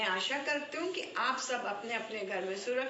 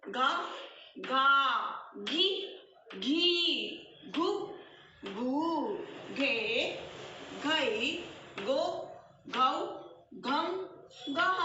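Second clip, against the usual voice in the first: quick rhythmic chant of the syllables; sing-song recitation; 3 semitones higher, much slower than usual. Shown as a demonstration. The teacher leads the children in reading the barakhadi aloud as a chant. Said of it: "एक बार फिर से पढ़ते हैं".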